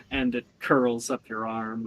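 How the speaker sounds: usual speaking voice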